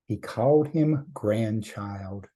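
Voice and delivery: usual speaking voice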